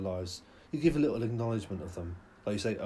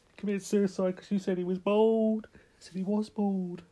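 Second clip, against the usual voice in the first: mock crying mum; 9 semitones higher, 5 dB louder than usual. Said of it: "She's crying".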